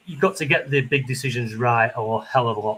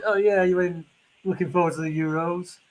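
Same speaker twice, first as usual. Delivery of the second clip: mocking politician; 6 semitones higher than usual. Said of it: "these politicians, like, try and".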